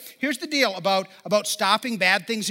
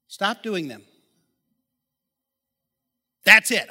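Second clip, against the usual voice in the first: dry mock-simple advice, a louder "That's it" as the punchline; sarcastic; 2 semitones lower, 3 dB louder, slower than usual. Shown as a demonstration. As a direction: say it like it's obviously that easy. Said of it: "I was being sarcastic".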